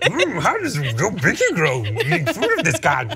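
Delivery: playful little-creature impression, swooping pitch; Yoda. Mischievous Jedi teasing someone over their food, hammed-up impression. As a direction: imitate the small mischievous Jedi master. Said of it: "Like Yoda is always messing with people".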